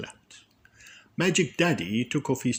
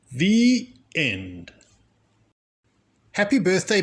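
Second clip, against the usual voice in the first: plain reading voice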